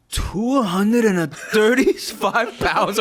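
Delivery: slow, high, drawn-out mock speechmaker; mimicked speech-giver. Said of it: "All I hear is, like".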